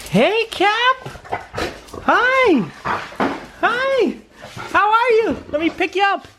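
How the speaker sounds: very high, sing-song pet voice, half scolding; dog-talk voice. Real, not acted. Owner fussing over his jumping dogs in high pet voice. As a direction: coo and fuss at the dogs like babies.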